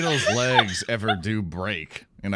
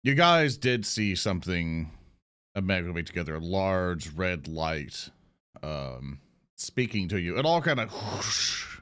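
scene narration. Acted. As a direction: set the scene calmly. GM sets the scene.

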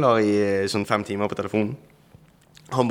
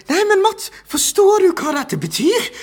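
shocked question; 6 dB louder than usual. Retold or imitated. Friend's shocked urgent question.

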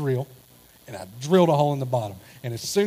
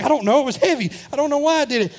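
high, loud flustered babble; panicked excuse; 11 semitones higher, 7 dB louder, faster than usual. Retold or imitated. Husband caught by his wife after dumping trash, panicked high yelp. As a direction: blurt it out in a panic, voice shooting up.

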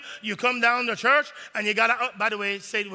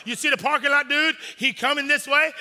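ordinary delivery

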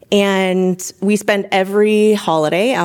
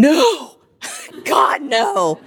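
inner scream; 8 semitones higher, much slower than usual. Retold or imitated. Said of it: "everything inside me is screaming".

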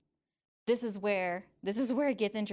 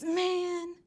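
put-on high, drawn-out teen whine; whining young teenager; 10 semitones higher than usual. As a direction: imitate a kid protesting in a high whine. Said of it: "when I was a young teenager".

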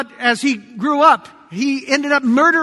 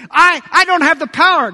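loud, higher, halting protest; protesting excuse; 4 semitones higher, 5 dB louder than usual. Acted. Moses makes excuses to God, halting protest.

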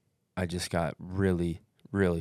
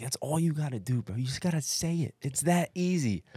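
higher-pitched, coaxing devil on the shoulder; tempting devil; 8 semitones higher than usual. Retold or imitated. Runner's inner devil urging him to quit, high coaxing voice. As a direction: coax them in a raised, pleading pitch. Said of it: "it was like the angel and the devil".

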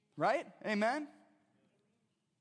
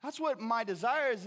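normal talking voice

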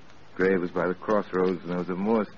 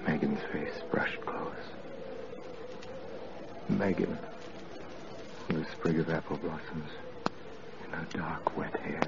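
whispered, much softer memory; haunted whisper; 3 semitones lower, 10 dB quieter than usual. Acted. Frank remembering drowned Megan, in a hushed whisper. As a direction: breathe it like a ghost passing.

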